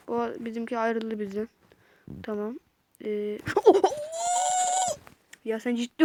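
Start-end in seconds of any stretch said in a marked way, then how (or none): 3.4-4.9 high squealing laugh-scream; laugh-shriek in shock; shrill startled laugh. Real, not acted. Enemy pops out unexpectedly.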